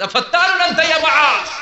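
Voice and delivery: sudden high, loud rebuke; scolding rebuke. Acted. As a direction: erupt at him with a sharp scolding. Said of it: "ধমক দিয়ে বললেন". (উঁচু গলায় কড়া ধমক)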